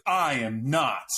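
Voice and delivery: slow, clipped-off indignant denial. Retold or imitated. Retelling a man asked if he's Mexican, indignant. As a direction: draw out each word, offended. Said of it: "he was so indignant".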